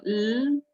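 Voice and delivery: "l" as a long, sustained voiced sound; voiced L alone. Shown as a demonstration. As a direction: hold the "l" by itself so the voicing is heard. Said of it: "cuando lo pronunciamos solo, sí tiene sonido".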